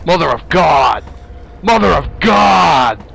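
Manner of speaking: loud drawn-out scream of Mother of God; terrified shout. Real, not acted. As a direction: shout it in real terror. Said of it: "Sorry for yelling".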